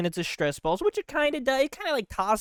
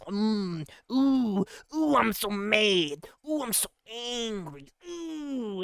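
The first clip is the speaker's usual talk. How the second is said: mock grumbling Earth; slower than usual. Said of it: "Earth gets a little".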